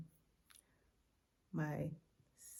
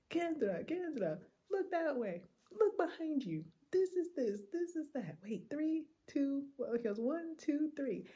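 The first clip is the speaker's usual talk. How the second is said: higher, lively guide calling out sights; eager tour guide; 11 semitones higher than usual. Retold or imitated. Taxi driver shows Kendra the sights, high and eager. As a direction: point out everything, excited host.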